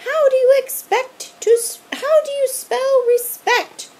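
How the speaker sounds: high, loud sing-song teacher voice; creepy strict teacher calling; teacher calling after fleeing students; monster teacher. A narrator's reading.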